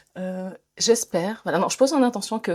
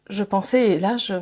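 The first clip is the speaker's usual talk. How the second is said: usual speaking voice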